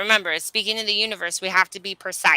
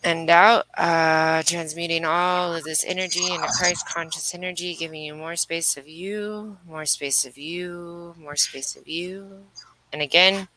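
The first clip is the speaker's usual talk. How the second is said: guided meditation; 3 semitones lower, 4 dB quieter than usual. Acted. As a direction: ease them through each breath, unhurried. Leads breathwork healing meditation.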